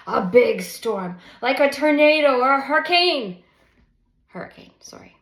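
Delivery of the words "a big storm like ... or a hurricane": loud, rising dramatic swell; loud dramatic storm image, rising high. A narrator's reading. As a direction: build it up like a storm. Mother describing a storm inside.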